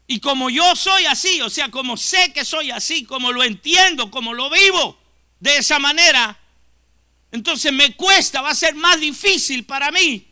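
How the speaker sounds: fervent pulpit preaching; full-force preaching. Acted. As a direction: preach it out, rising with conviction.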